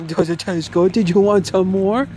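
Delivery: high, sing-song praise to a pet. Real, not acted. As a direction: coo at the dog in a high voice. Baby talk to dog.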